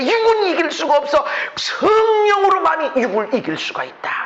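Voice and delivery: high, ringing preacher's proclamation; full-force preaching. Acted. Pastor rousing the congregation, high full-force preaching. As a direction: lift the room with a new beginning.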